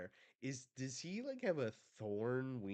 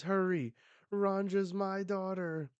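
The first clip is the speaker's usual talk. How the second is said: higher-pitched urgent call; urgent plea; 8 semitones higher than usual. Acted. Parent pleading for daughter Ranja, urgent.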